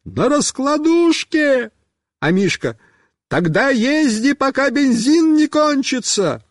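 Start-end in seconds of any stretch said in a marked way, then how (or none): 0.0-1.7 high, drawn-out answer; stretched high yell; shouting. A narrator's reading. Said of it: "Я кричу". 3.3-6.4 high-pitched shouted advice; high calling voice; calling back. A narrator's reading. Friend shouts back to rider.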